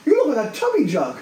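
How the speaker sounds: high cooing Irish lilt; old Irish man. Retold or imitated. Old Irish man in Ireland teasing him, high coo. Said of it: "looks at me and goes".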